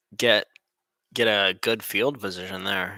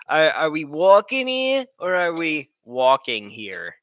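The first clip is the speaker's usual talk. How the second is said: hammed-up Brooklyn "walking here", raised; mock New York accent; 5 semitones higher, 4 dB louder than usual. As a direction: tease the roommate's fake New York talk. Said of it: "Every other word".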